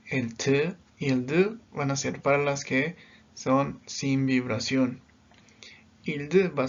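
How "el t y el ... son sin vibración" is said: normal talking voice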